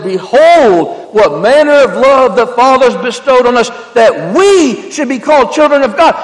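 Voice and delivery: loud, ringing scripture reading; preaching scripture aloud. Acted. Preacher reading Revelation to his congregation, loud. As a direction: proclaim it to the whole church. Reads scripture at full force.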